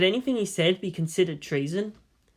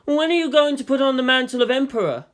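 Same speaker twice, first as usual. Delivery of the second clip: put-on higher, teasing older man; character voice; 8 semitones higher, 5 dB louder than usual. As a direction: tease him with the question. Yang teasing the boy.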